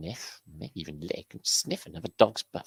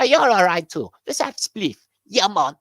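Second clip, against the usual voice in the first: much higher, louder character voice; lightly high voiced mate; high jeering voice; 13 semitones higher, 11 dB louder than usual. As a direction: toss it off like the heckler.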